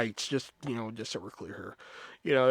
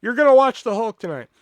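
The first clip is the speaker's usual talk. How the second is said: louder, higher shout at the customers; mock scream; 6 semitones higher, 7 dB louder than usual. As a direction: yell it in exasperation. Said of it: "about to just scream at these people".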